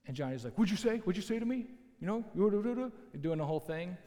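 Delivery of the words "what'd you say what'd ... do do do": higher-pitched mock challenge, jabbering off; mock jawing. Kickboxer squaring up to Mike, higher mock jawing. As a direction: imitate a cocky gym loudmouth jawing. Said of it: "Johnny was jawing like he usually was".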